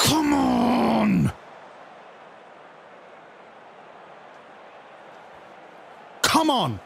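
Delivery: high, ringing cheer of triumph; excited cheer. Real, not acted. Streamer celebrating the win, high excited cheer. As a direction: let it out like the final whistle.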